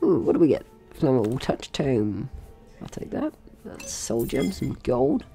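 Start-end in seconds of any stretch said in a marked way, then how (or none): none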